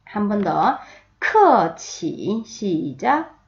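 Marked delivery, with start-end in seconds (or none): 1.2-2.4 크어치 very slow, each tone stretched out; tone drill. Shown as a demonstration. Teacher starting tone practice, 크어치 slow and drawn out. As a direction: draw out both tones of 크어치. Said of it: "성조 연습 먼저 해볼게요".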